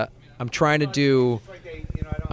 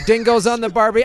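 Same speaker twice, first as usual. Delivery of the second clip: mock Australian accent; 6 semitones higher, 4 dB louder than usual. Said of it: "I don't know your terms".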